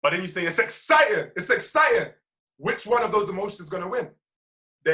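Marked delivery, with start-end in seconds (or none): 0.5-2.1 loud, emphatic enthusiasm, punching "exciting"; punchy, enthusiastic affirmation. Shown as a demonstration. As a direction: say "exciting" with emotion, louder and lifted. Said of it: "say it with emotion and enthusiasm".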